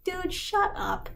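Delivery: drawn-out high deadpan brush-off; high, slow mock dismissal. Valjean tells dramatic Javert to stop, mock dismissive. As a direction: cut him off like he's being ridiculous.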